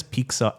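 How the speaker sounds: slow "pizza" said as "peek-sa"; Jose's mispronunciation. Retold or imitated. Repeating Jose's word, mispronounced. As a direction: swap the T in "pizza" for K. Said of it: "Jose says pizza".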